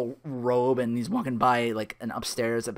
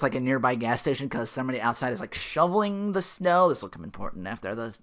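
ordinary talk

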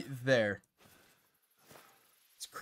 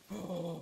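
sharp gasp; 12 dB quieter than usual. Real, not acted. Startled by something in the game.

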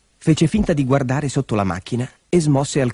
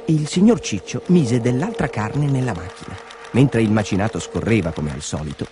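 usual speaking voice

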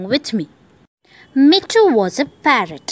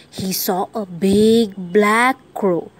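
ordinary delivery

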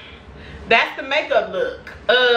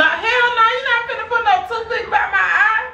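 shrieking no near the eye; alarmed protest; 11 semitones higher than usual. Real, not acted. Refusing toothpick near eye.

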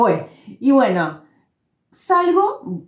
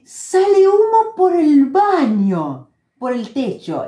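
high, slow, stretched cry of alarm; alarma imitada; 6 semitones higher, 4 dB louder, slower than usual. Retold or imitated. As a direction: retell a panicked warning, drawing it out. Retold alarm about bathroom smoke. (aviso alarmado, agudo y alargado)

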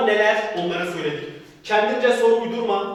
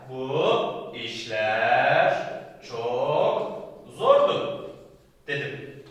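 slow dictation; 7 semitones lower, much slower than usual. Shown as a demonstration. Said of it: "dictating with long pauses".

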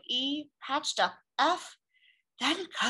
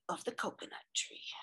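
whispering; 11 dB quieter, faster than usual. Acted. Secret meeting whispered.

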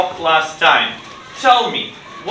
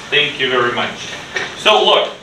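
ordinary talk, nothing marked

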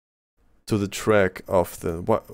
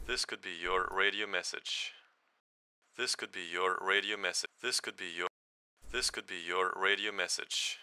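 thin, band-limited radio voice; radio filter; 14 dB quieter than usual. Shown as a demonstration. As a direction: sound like a cheap walkie-talkie. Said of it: "use a low pass filter".

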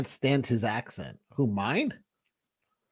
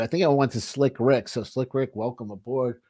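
ordinary talk, nothing marked

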